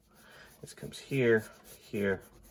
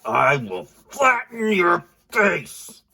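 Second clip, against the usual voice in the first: slow, high, loud cartoon monster threat; 9 semitones higher, 7 dB louder, much slower than usual. The drawn monster threatens the viewer, slow loud monster voice. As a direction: drag out each word like a menacing cartoon monster. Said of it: "He's like".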